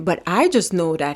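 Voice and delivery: ordinary delivery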